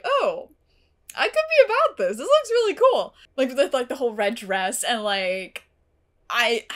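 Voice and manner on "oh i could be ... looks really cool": much higher, louder gushing delight; excited fangirl gush. Retold or imitated. First sight of the villain, high excited gush. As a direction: squeal it like a fan seeing a cool villain. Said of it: "Like, I was like".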